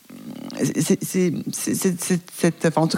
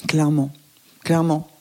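ordinary delivery

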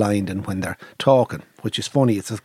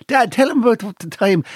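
put-on higher-pitched child with a Clare accent; 11 semitones higher, 3 dB louder than usual. Young Clare nephew prompting dad at the table, eager Clare accent. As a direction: imitate an eager Crusheen boy. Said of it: "in his Clare accent".

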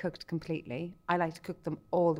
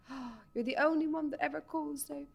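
put-on a clumsy, higher English accent for the butcher; mock the butcher in a poor English accent; bad English accent; 10 semitones higher than usual. The butcher chatting on the phone, in a badly faked English accent. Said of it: "I'm really bad at the English accent".

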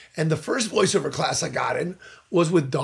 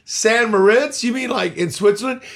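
louder, higher, stunned whoa-wait; stunned disbelief; 4 semitones higher, 7 dB louder, slower than usual. Retold or imitated. Reacting to St. Moritz, loud and incredulous. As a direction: stop him in loud disbelief.

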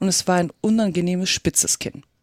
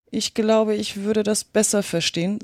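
plain reading voice

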